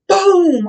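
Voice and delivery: a sudden loud shouted boom; explosion boom. Retold or imitated. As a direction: blast it out like an explosion. Imitating the explosion sound.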